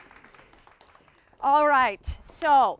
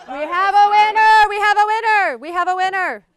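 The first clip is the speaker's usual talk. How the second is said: loud, high winner announcement; excited announcement; 8 semitones higher, 16 dB louder than usual. Real, not acted. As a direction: call it out like a game show host. Host announces the game winner.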